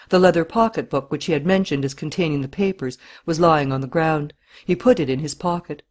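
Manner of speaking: ordinary delivery, nothing marked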